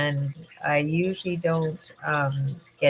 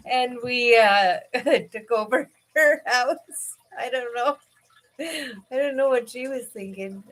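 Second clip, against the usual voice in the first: laughing; 6 semitones higher than usual. Real, not acted. Still laughing at memory.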